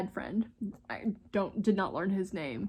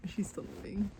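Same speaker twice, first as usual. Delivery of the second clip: whisper; 9 dB quieter than usual. Retold or imitated. Retelling a tense roadside moment.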